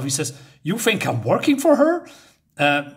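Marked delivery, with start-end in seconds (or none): 0.6-2.1 higher, indignant question; angry retort. Retold or imitated. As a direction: throw the accusation back, offended. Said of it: "he's getting pissed off".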